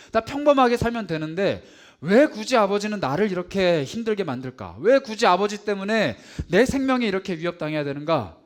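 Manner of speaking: loud, high, fervent complaint; bitter lament. Retold or imitated. Preacher voicing Joseph's loud grievance against his father. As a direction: pour out resentment at full force.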